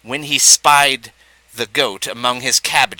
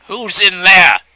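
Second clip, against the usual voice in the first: loud, higher angry demand of a fox; angry call; 6 semitones higher, 7 dB louder, slower than usual. A narrator's reading. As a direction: call out sharply into the den. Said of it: "she called angrily".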